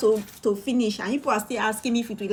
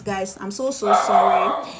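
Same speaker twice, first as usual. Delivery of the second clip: normal talking voice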